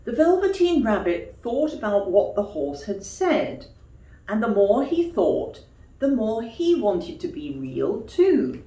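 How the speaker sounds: ordinary delivery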